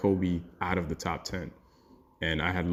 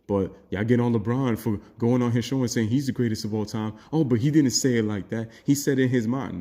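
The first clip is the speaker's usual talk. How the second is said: higher, louder mocking whine of a defensive fanboy; 5 semitones higher, 5 dB louder than usual. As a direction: mimic a defensive fan, pitched up. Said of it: "fanboys are gonna go crazy about".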